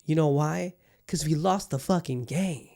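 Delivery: ordinary talk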